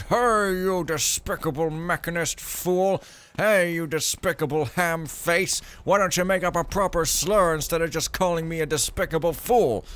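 loud, high hammed-up insult across the street; mock shouting. Imagined mechanist yelling insults across the street, hammed-up shout. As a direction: yell the insults like a petty rival. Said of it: "shouting at each other across the street".

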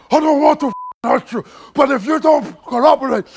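retold gunman threat. Angry high gunman threatening.

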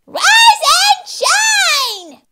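high, loud, drawn-out wake-up shout; bossy piercing call; yelling. Acted. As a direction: holler it slowly, stretching each word. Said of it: "she yelled".

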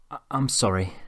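soft, stammered apology; hesitant. A narrator's reading. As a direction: falter shyly on the first word. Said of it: "said Doris, hesitating".

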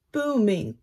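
plain reading voice

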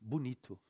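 bonito repeated softly as "bunitu"; Brazilian pronunciation. Shown as a demonstration. Teacher repeating the spoken form, soft "bunitu". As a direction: end bonito on a u. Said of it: "but we pronounce it".

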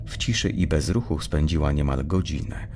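ordinary delivery, nothing marked

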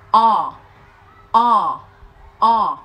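"O" as long open English ah, mouth dropped tall; long open vowel. Shown as a demonstration. Teacher models the English vowel for learners, "o" long and open. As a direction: draw out "o", jaw moving straight down. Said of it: "Miệng chúng ta sẽ di chuyển theo chiều dọc".

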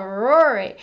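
normal talking voice

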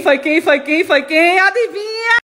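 high, loud playful question; teasing guess. Real, not acted. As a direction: tease them to guess. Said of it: "quem acabou de chegar da escola foi quem?". (adivinha em tom alto e brincalhão)

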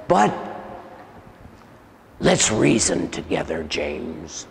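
low demon voice, sinking soft on the name; deep man's voice. Retold or imitated. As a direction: imitate a demon coaxing, dropping away quietly. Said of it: "in a deep man's voice".

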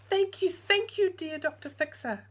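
high, loud thankful cry; grateful cry. Acted. Mother thanking the doctor, high and loud. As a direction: burst out in thanks. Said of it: "cried Missus Bubble".

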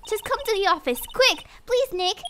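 high, pressing entreaty; urgent plea. Acted. Maya begging Nick to come, urgent.

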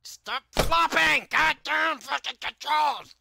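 high, slow, loud raging yell; furious yell. Real, not acted. Player screaming at the floppy game controls, slow high furious yell. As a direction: drag out the rage at the controls.

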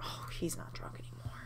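whispered disappointed aside. Real, not acted. Player noticing her Sim sobered up, whispered. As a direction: drop to a whisper. Aside after failed plan.

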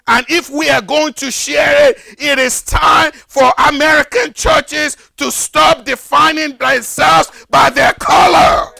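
urgent sermon appeal; forceful preaching. Acted. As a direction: call the churches out from the pulpit. Preaching rebuke to American churches.

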